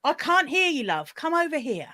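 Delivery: put-on London accent with a little twang, falling away. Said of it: "in a kind of slightly [name] type British".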